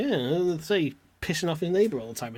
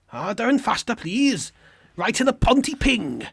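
raised, breathy-free sing-song Welsh plea; lightly done Welsh accent, a bit higher; mock Welsh voice; 8 semitones higher, 4 dB louder than usual. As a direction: put on a hammy Welsh accent begging. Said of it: "not a real Welsh word".

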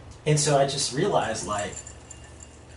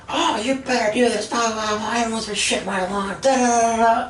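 much higher, louder mock shriek of the neighbor; imitated lady yelling; 11 semitones higher, 5 dB louder than usual. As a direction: crank her up high and loud, hamming the rant. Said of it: "She's yelling so loud".

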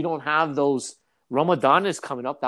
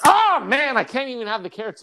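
high wail, loud at the start; pained mock refusal; 9 semitones higher, 5 dB louder than usual. Guest refusing vegetables that touched chicken, pained. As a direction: lament it, comically overdone.

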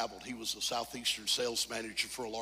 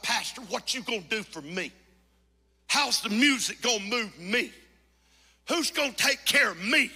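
higher, louder, needy demanding whine; mocking self-centred churchgoer; 10 semitones higher, 10 dB louder than usual. Pastor mocking narcissistic churchgoers, needy whine. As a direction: whine like a member who wants to be served. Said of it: "It's all about".

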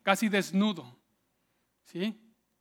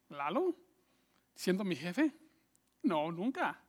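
softer, incredulous questioning, then dismissal; envious disbelief; 3 semitones lower, 8 dB quieter than usual. Acted. Envious coworker doubts Lalo as boss, quiet scoffing disbelief. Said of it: "siempre hay alguien que dice".

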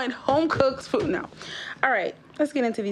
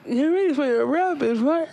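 host voice; 4 semitones higher than usual. Retold or imitated. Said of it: "You know how I be like".

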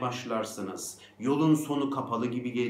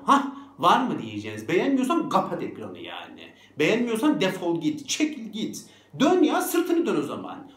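loud, rising, feisty dismissal; mock-angry, building in pitch and force; defiant retort; 5 semitones higher, 4 dB louder than usual. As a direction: send the critics packing. Acted defiance at critics.

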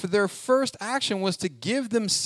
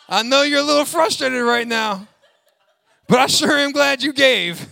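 louder, high, laughing mock sympathy; looser mock God; 4 semitones higher, 4 dB louder than usual. Pastor mocking a God who praises grudging givers, hammed up and laughing. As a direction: ham up the sarcastic God with a laugh. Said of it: "Get a little loose".

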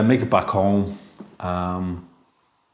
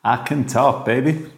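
slow, stretched PA announcement with a laugh in it; mock store PA announcement, gleeful; over the store microphone; 4 semitones higher, slower than usual. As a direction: boom it out over the tannoy, slowly. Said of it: "go into one of the microphones and be like".

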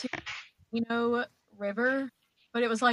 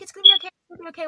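teacher reassuring; 7 semitones higher, 10 dB louder, much faster than usual. Retold or imitated. Teacher soothing stung child.

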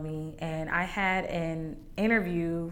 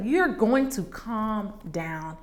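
slow, firm, steady command; calm her down slowly; slow firm reassurance; 4 semitones higher, 3 dB louder, much slower than usual. Retold or imitated. Godmother steadying her through panic, slow and firm. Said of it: "she was like".

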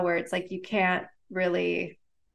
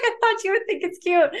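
worried friend; 11 semitones higher than usual. Retold or imitated. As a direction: voice the friend's startled apology, pitched up. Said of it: "she's like".

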